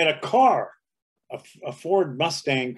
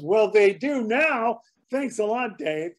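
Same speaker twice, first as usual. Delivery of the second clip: slow, higher, deadpan thanks; dry sarcasm; 7 semitones higher, slower than usual. Retold or imitated. Clark Kent needling the host, slow dry sarcasm. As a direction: drag it out, mock-grateful.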